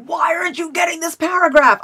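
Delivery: high-pitched exasperated mock shout; mock self-scolding. Writer imitating how she'd berate herself when stuck on a chapter, exasperated.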